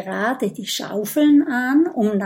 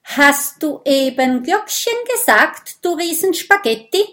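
indignant Mathilda; 8 semitones higher, 4 dB louder than usual. Acted. Eine Stimme.

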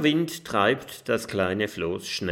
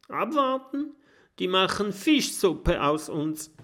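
much higher, disbelieving outcry; incredulous; 10 semitones higher than usual. A narrator's reading. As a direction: throw the word back in disbelief. Mathilda rejects waiting. (hoch und ungläubig)

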